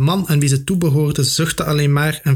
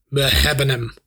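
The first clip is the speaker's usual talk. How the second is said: rauwe stem. A narrator's reading. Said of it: "zei een rauwe stem".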